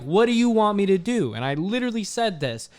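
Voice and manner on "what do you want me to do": loud heated retort; retold angry rant. Worker to new manager, loud heated retort. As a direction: snap back at the boss, fed up. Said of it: "I'm like".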